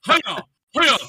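mock the default character creation voice; default game voice. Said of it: "You're the default voice".

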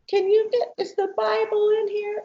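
put-on a daughter half-awake, sounding like a very little girl. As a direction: sound like a small tired child asking for the Bible. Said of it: "she's talking like this".

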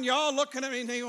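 ordinary delivery

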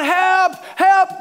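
high, loud mock cry for help. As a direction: call out like someone dangling off a cliff. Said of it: "he's crying out".

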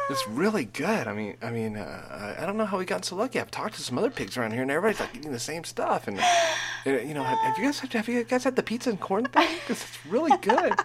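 high, chatty pig speaking for itself; lightly voiced happy pig. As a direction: play a cheerful farm pig talking about its food. Voicing a pig on steak fingers.

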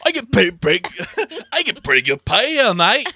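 hammed-up Australian accent, high and loud; rowdy cartoon Australian accent with a laugh in it; mock Australian accent. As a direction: yell like a crude Aussie cashier, in a high Australian accent. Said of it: "talking like that".

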